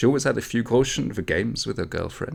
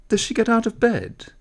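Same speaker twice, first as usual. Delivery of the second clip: high, louder nosy question; nosy villager, pitched up and louder; prying girl; 6 dB louder than usual. A narrator's reading. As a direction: push the question like a curious girl. Girls prying, voiced.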